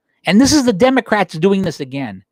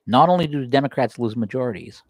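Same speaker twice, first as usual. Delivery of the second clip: usual speaking voice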